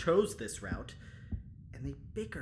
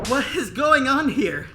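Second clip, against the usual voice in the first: loud demand; 11 semitones higher, 14 dB louder than usual. Acted. Warren arrives at commotion.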